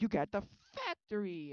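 loud, slow, grand announcement; excited Wonka. Retold or imitated. Wonka tells Charlie he gets the factory, grandly. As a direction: proclaim it like a prize. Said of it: "And he's like".

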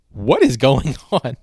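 slow, loud confused question; baffled guest. Retold or imitated. Dinner guest hearing the inside joke, slow baffled question. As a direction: sound lost and bewildered.

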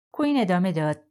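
ordinary delivery, nothing marked

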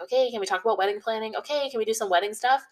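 mild nagging. Acted. Pestering a partner about wedding planning, mildly nagging. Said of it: "sort of nagging him".

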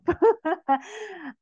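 high, loud cackling laugh; real laugh. Real, not acted. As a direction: burst out laughing at yourself. Laughing at own reaction.

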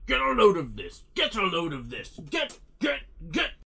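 hammed-up cartoon villain yell, cut into repeats; mock screaming. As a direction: imitate a shouted game catchphrase stuck on repeat. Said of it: "Unless he's screaming".

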